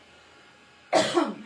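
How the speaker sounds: loud, harsh clearing of a tickly throat. Real, not acted. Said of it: "'cause I've got myself choked".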